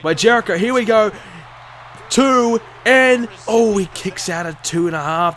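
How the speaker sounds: rising ringside count call; wrestling commentator. Acted. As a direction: build the count, then release. Live match commentary.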